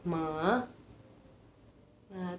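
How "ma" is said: ma dipping lower first, then rising; ma dropping low then coming back up; third tone. Shown as a demonstration. Teacher repeating the third tone for students, dip then rise. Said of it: "Jadi turun dulu, terus naik".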